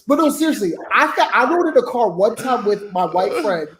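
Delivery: ordinary talk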